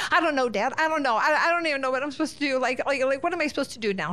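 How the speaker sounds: imitating a helpless kid spilling out worry; flustered son. Son to dad about burst pipes, flustered and helpless.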